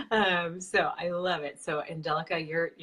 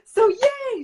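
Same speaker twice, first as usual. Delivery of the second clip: excited cheer; 11 dB louder than usual. Real, not acted. Cheering to start interview.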